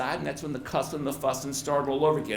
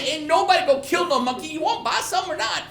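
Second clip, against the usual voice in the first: papa; 12 semitones higher, 6 dB louder than usual. Retold or imitated. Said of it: "And Papa said".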